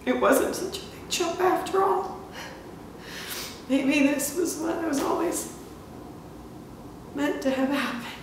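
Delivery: fighting back tears; close to tears. Acted. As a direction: voice climbing as the dread sinks in. Tearful monologue, fears becoming like mother.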